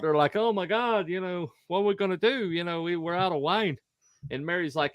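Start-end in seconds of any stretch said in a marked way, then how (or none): 0.4-3.8 raised-pitch, lightly acted panic; mock freaking out. Wedding hosts out of wine, mock panic. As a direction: play the fretting hosts, pitched up. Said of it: "they're all freaking out".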